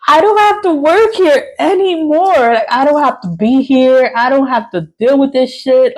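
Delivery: high-pitched, giddy declaration of freedom; gleeful liberation. Retold or imitated. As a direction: reenact the joy of realizing you can quit. Acts out gleeful realization of freedom.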